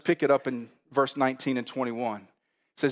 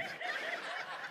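short high burst of laughter; 13 dB quieter than usual. Real, not acted. Pastor laughing at his own dated joke, high laugh.

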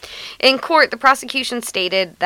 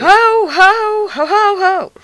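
put-on high, loud drunken Santa laugh; ho-ho-ho like a sloshed Santa; town drunk; 11 semitones higher, 6 dB louder, much slower than usual. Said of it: "if I was a seventy-three-year-old mall Santa".

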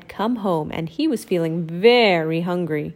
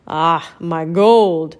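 lower, loud, very slow gloat; greedy giant; 4 semitones lower, 6 dB louder, much slower than usual. Acted. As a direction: savour the gold. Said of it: "said the giant".

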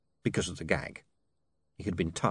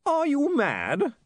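incredulous; 3 dB louder, much slower than usual. A narrator's reading. Carney aghast.

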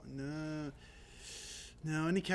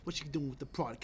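put-on Baltimore accent; 3 semitones lower, 6 dB quieter than usual. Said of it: "catching myself going".

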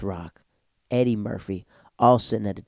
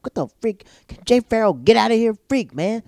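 annoyed inner voice; 10 semitones higher, 5 dB louder than usual. Retold or imitated. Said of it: "I'm like".

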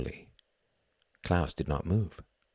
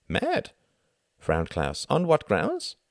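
put-on higher, puzzled German-accented voice; 11 semitones higher, slower than usual. A narrator's reading. Klaus questioning Tom's word, puzzled German accent. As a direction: Klaus genuinely baffled, German lilt.

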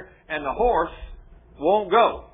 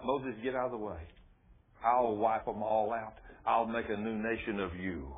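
voice of God; 8 semitones lower, 6 dB quieter than usual. Acted. God telling Moses to step aside, low and soft. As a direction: speak as God, deep and quiet. God threatening to wipe out people.

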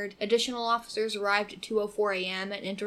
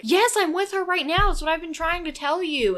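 higher, louder imitation of a frantic caller; desperate caller; 8 semitones higher, 7 dB louder than usual. Retelling a 911 call, the girl's frantic cry. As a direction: echo her panic, pleading loudly.

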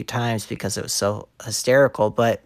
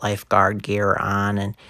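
ordinary talk

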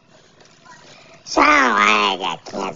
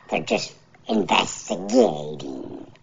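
normal talking voice

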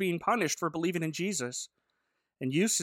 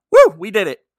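loud hammed-up victory whoop; mock cheer; 16 dB louder than usual. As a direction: cheer it like a smug win. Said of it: "And Shmuel's like".

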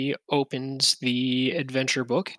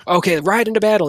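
quick, loud, higher mock order; mock battle command; 6 semitones higher, 6 dB louder, much faster than usual. Player teasing about the dog, in a mock battle command. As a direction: playfully urge a dog into battle.

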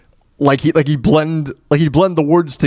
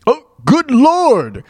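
normal talking voice

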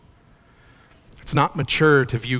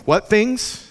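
higher, louder impassioned sermon; fervent preaching; 5 semitones higher, 3 dB louder than usual. Acted. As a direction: preach it with rising fervor.

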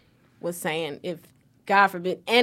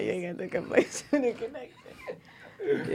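laughing repeat; 5 semitones higher, 9 dB quieter than usual. Real, not acted. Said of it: "I like that one".

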